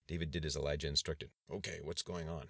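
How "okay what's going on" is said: understated gruff, softer voice. A narrator's reading. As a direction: disguise the voice a little over the phone. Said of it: "it's important that you speak gruffly".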